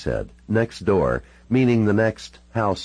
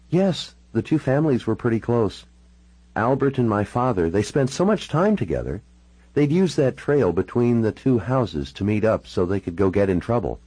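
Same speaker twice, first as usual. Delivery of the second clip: higher-pitched woman's character voice; Natalie recalling family; 5 semitones higher than usual. Acted. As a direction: recount family history in a raised-pitch voice.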